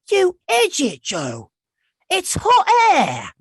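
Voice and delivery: put-on high, loud, drawn-out British boy exclaiming; British schoolboy scolding himself, pitched high; British boy impression. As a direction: cry out in an exaggerated British accent. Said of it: "the way he said it".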